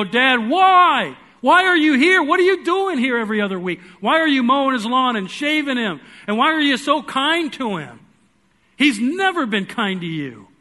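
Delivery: high, loud, pleading why; twelve-year-old boy pressing his dad with loud, high questions; boy's angry demand. Retold or imitated. A boy confronting his dad about grandpa, high and loud.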